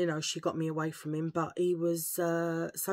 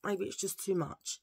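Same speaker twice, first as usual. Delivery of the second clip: ordinary delivery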